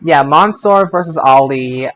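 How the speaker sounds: ordinary delivery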